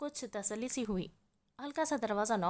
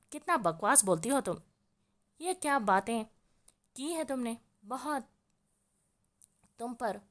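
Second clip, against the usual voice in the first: scolding. A narrator's reading. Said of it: "वो इसको डपटती बोली थी".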